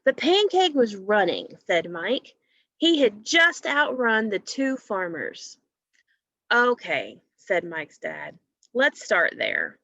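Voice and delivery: lively storybook reading, a little slow; reading to children. Acted. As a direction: read it aloud for young listeners.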